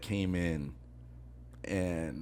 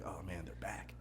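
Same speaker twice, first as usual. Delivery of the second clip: very low, hushed aside; muttered dread; 4 semitones lower, 16 dB quieter than usual. Retold or imitated. Waiter spotting dreaded regulars, muttered under his breath. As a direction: say it under your breath, nearly whispering.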